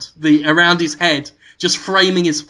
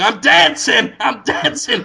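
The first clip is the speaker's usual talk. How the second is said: Adam covering for it; 5 semitones higher than usual. Retold or imitated. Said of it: "start going".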